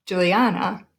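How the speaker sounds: "Juliana" as ju-li-AH-na; stress on third syllable. Shown as a demonstration. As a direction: lean on the AH in "Juliana". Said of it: "we pronounce it emphasizing the third syllable".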